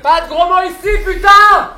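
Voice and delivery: high, loud scolding shout; angry reprimand. Acted. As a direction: bellow the order at a customer. Patron engueule la cliente. (engueulade criée)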